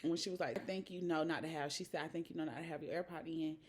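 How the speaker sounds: dropped way down, soft and close; hushed retelling. Real, not acted. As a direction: tell it in a low confiding voice. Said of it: "storytelling quietly to camera".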